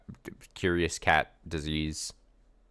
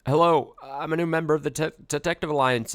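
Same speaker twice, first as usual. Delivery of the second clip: higher, louder nervous newcomer greeting; keen rookie stammering a hello; eager new recruit; 9 semitones higher, 4 dB louder than usual. Acted. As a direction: introduce yourself brightly, stumbling on the word. Voices protagonist greeting on entry.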